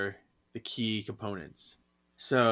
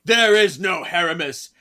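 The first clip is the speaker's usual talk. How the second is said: loud, high, booming proclamation; booming villain voice; 6 semitones higher, 9 dB louder than usual. Retold or imitated. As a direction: announce it like a possessed demigod. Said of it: "But he's like".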